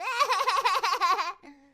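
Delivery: big loud laugh; loud burst of laughter. Real, not acted. Streamer laughing hard at his own banter, loud burst of laughter.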